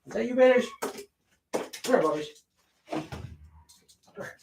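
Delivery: high-pitched coaxing call to a dog; pet-calling voice. Real, not acted. Owner calling Vader over from upstairs, high coaxing voice.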